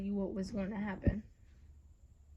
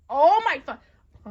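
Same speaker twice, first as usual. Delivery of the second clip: loud, drawn-out higher yell, cut short; real exasperated shout, broken off; exasperated outburst; 5 semitones higher, 16 dB louder, much slower than usual. Real, not acted. Exasperated outburst interrupting the reading.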